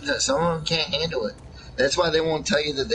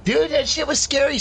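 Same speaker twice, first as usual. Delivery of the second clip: excited; 12 semitones higher than usual. Real, not acted. Excited recalling scary show.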